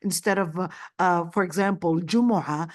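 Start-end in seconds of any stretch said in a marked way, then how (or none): none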